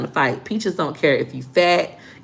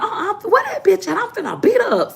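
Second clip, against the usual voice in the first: high furious sister; 13 semitones higher than usual. Retold or imitated. Sister ready to fight, voiced.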